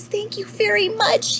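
very high, delighted little voice; excited lemur. A narrator's reading. Lemur thanks panda for all the donuts, excited. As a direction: squeal the thanks like a thrilled small creature. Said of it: "The lemur's so excited".

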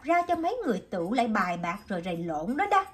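a stall owner scolding rowdy gamblers, rising to loud; scolding shopkeeper. Acted. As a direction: scold them, pointing with the chopsticks. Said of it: "chị nghe rầy lộn thì dây vô".

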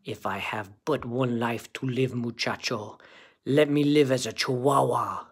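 put-on low, quiet Spanish-accented voice; soft, low-pitched chihuahua with a Spanish accent. A narrator's reading. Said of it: "using his very best Spanish accent".

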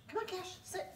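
high sing-song coaxing; high coaxing dog voice. Real, not acted.

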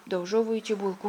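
usual speaking voice

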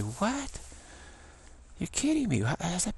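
high, stunned disbelief; mock amazed golfer, pitched high. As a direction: imitate a golfer who can't believe the drive. Golfer amazed at pro's drive.

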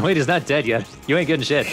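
ordinary talk, nothing marked

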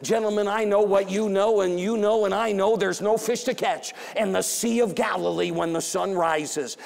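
ordinary talk, nothing marked

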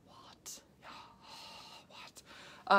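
breathless whispered disbelief; stunned what. Real, not acted. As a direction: whisper it in shock. Stunned by tiny diet.

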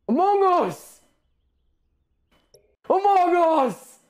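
high, hyped-up game title cry; hammed-up high exclamation; excited cheer. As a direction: call out the title like a gleeful fan.

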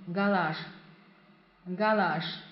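гараж drawn out slowly; slow model pronunciation. Shown as a demonstration. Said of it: "teacher models the word slowly".

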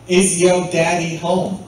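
loud, hammed-up Southern accent; playful Southern US accent, projected. Shown as a demonstration. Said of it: "That is my southern accent".

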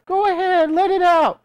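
very high-pitched put-on coaxing; exaggerated, high-pitched false encouragement; mock baiting. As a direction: egg them on in a high mocking voice. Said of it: "they'll even bait you".

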